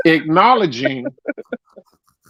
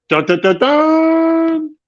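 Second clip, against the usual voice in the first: drumroll hum; 11 semitones higher, slower than usual. Pretend. Announcing awards, drumroll sound.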